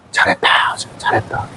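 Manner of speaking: soft, hushed praise, fading; tender approval. Acted. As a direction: give quiet, fatherly approval. God praises the one enduring.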